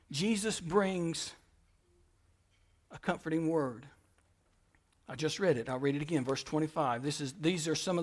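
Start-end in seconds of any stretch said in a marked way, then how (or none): 0.1-3.9 gentle and soft. Acted. Tender line about Jesus comforting.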